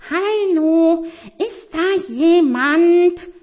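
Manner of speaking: hoch und laut ins Dunkle gerufen; lauter gerufen. Acted. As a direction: diesmal laut rufen, langsam und gedehnt. No answer, calls louder. (Tiger ruft laut, weil keiner antwortet)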